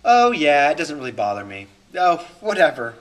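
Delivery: put-on nonchalance, loud breezy openings that drop away; facade of indifference. Covering up being bothered by criticism, fake unconcern. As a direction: brush it off, pretending not to care. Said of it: "Or facade".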